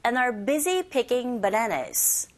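slow, stretched reading of the sentence; slow sentence model. Shown as a demonstration. Teacher shares the whole sentence for learners to repeat, slowly. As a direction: read slowly, word groups drawn out. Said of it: "sharing the sentence as well".

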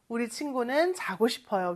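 usual speaking voice

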